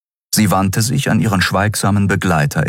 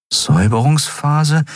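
puzzled rising question; confused; 11 semitones higher than usual. A narrator's reading. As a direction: repeat it baffled. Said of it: "wiederholte Endriel verwirrt".

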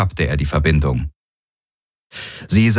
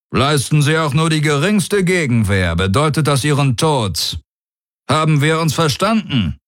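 high, firm warning; stern warlord; 9 semitones higher than usual. Acted. Daraios threatening death for any resistance, high and stern. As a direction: lay down the ultimatum firmly.

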